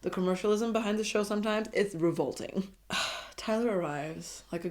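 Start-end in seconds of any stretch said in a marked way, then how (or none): none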